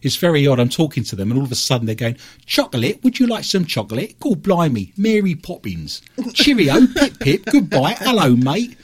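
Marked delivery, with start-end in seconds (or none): 2.5-8.8 high, hammed-up English accent; mock people badly imitating an English accent; exaggerated, singsong fake English. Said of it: "talking in a fake English accent".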